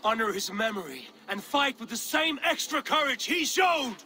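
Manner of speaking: a chieftain stirring his people to fight; booming call to honor; rallying shout. Acted. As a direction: raise the voice high and loud to rouse the warriors. Rallying warriors at a funeral.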